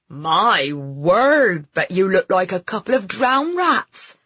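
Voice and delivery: amused landlord in broad, swooping Somerset; strong Somerset accent. A narrator's reading. Landlord teases two drenched guests, Somerset accent. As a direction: marvel at them in a West Country Somerset voice. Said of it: "in a strong Somerset accent".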